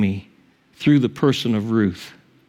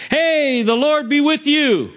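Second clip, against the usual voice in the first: calling out a greeting; 13 semitones higher than usual. Acted. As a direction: call a blessing out to the workers. Boaz calls out to workers.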